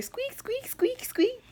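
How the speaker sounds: very high little squeaks; squeaky scrubbing noise. Retold or imitated. Over here cleaning dishes, high squeaky sound effect.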